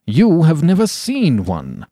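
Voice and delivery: schoolmaster's drawn-out reproof; stern schoolmaster. A narrator's reading. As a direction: contradict children slowly and emphatically. Schoolmaster rebukes the children.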